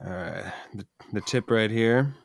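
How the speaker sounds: ordinary talk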